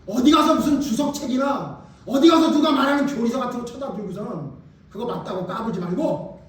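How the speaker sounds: ordinary talk, nothing marked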